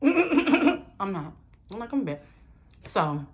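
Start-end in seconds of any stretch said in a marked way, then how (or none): 0.0-2.2 high, loud laughing "I'm not"; laughing defiance. Real, not acted. Laughing back at her father's doubt.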